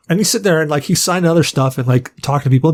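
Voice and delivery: ordinary talk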